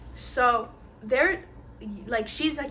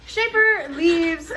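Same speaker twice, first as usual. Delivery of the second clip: laughing; 6 semitones higher, 5 dB louder, much slower than usual. Real, not acted. Laughing at own story.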